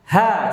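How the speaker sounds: "Ha" with a plain open, thin A; unrounded, thin "Ha". Shown as a demonstration. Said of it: "Turun suaranya".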